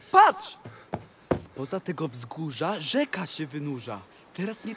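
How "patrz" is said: sharp high cry of discovery; sudden look. Acted. Cat urges his master, suddenly spotting the river. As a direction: point and shout it out.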